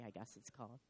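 ordinary talk, nothing marked

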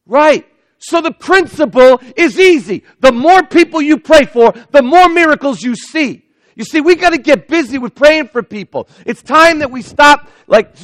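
high, loud, driving preacher's call; preaching at full force. Acted. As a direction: hammer the point home to the congregation.

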